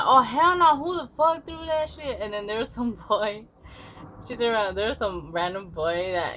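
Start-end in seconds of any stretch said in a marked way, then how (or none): 0.0-2.2 high, loud mock outrage; hammed-up furious bad girl demanding who threw it; angry tough girl. Girl yelling at the class, high mock furious voice.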